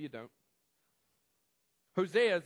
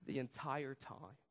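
hushed; 2 semitones lower, 6 dB quieter, slower than usual. Acted. Quiet then loud repeat.

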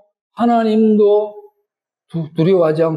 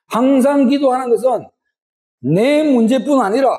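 high, rising preacher's plea building in force; impassioned sermon climb; preaching at full force; 5 semitones higher than usual. Acted. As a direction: drive the call home to the congregation.

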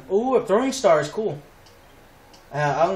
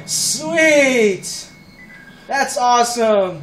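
loud, high, stretched-out cheer; elated; 5 semitones higher, 4 dB louder, much slower than usual. Real, not acted. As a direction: savour the win out loud. Celebrating a double kill.

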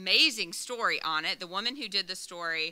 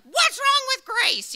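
high, loud panicked cry; panicked mom; 7 dB louder, slower than usual. Retold or imitated. Mom answering her daughter's call on the road, panicked shout. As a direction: shriek it like a frightened mother hearing bad news. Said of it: "thinks there's something wrong with my kid".

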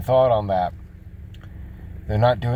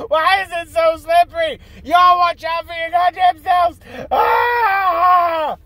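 high, loud screaming at other drivers; road rage yell; 6 dB louder than usual. Retold or imitated. Yelling at drivers cutting into his lane. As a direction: yell it like stuck in slippery traffic. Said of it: "'Cause I was driving down the road going".

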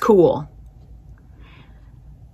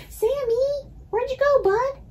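much higher, sing-song call to the cat; 8 dB quieter than usual. Real, not acted. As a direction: coo out for the cat who wandered off. Calling her missing cat.

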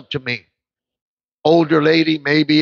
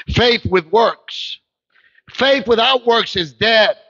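slow, building to higher; slow emphatic preaching; 3 semitones higher, slower than usual. Real, not acted. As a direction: build the verse slowly. Preacher drives point.

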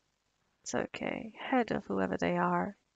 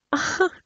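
a sudden loud, higher burst of laughter; delighted loud laugh; 10 semitones higher, 9 dB louder than usual. Acted. Vampire greeting her returning mate, loud delighted laugh.

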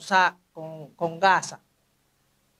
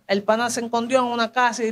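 ordinary delivery, nothing marked

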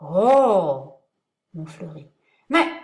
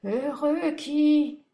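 high, very slow, fading; shrinking smaller and smaller; 7 semitones higher, 4 dB louder, much slower than usual. Acted. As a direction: let the voice shrink away. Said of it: "d'une voix de plus en plus faible".

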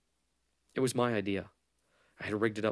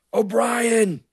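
full-out high, loud yell; 14 semitones higher, 14 dB louder than usual. A narrator's reading. Jorgensen yelling O'Brien's name before he fires, full shout. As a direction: shout the name across a distance. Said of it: "he yelled".

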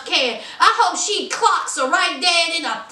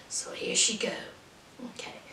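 whispered aside; 6 semitones lower, 16 dB quieter, faster than usual. Real, not acted. Aside about her complaining.

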